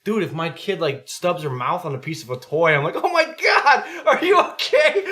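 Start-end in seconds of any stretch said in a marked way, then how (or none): 2.9-5.0 high, loud mock panic; panicked parent. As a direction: shriek with exaggerated parental alarm. Parent panicking over child's small bump.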